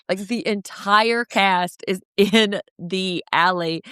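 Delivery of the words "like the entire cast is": ordinary talk, nothing marked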